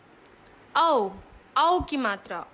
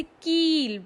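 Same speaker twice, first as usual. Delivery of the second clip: slow example word; 3 semitones higher, much slower than usual. Shown as a demonstration. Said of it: "ई की मात्रा".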